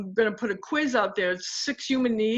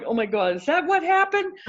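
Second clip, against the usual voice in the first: surprised; 7 semitones higher than usual. Retold or imitated. Surprised reaction retold.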